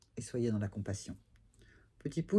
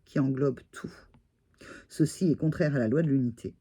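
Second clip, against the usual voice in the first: louder, formal reading of the card; louder solemn card reading; reading the card aloud; 4 dB louder than usual. Acted. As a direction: read the oracle text out like a proclamation. Reads card text aloud.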